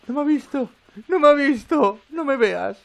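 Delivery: high panicked squeaky pleading, no me mires; terrified high pleading. Real, not acted. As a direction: beg the monster not to look, voice cracking high. Terrified pleading as monster passes. (súplica aterrorizada y aguda)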